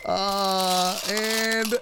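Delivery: normal talking voice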